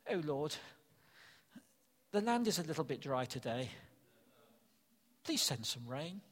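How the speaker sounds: polite prayer. Retold or imitated. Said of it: "No, it wasn't like that at all".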